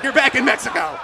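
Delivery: high, loud pop-up announcement; gleeful border voice. Retold or imitated. Border popping out of nowhere at celebrating friends, shouted. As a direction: play a border jumping out with a shout.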